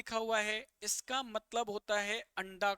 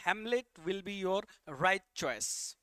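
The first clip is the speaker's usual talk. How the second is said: slow announcement; much slower than usual. Shown as a demonstration. Teacher announces answer slowly.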